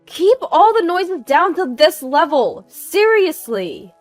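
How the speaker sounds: high, loud command; angry scolding. Acted. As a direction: order them with exasperation, slowing at the end. Neighbor scolding over the phone.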